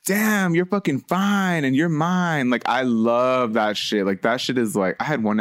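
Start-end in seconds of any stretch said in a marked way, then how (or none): 0.1-2.5 flirty compliment. Retold or imitated. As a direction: lay on the charm slowly. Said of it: "a guy that I'm dating is like".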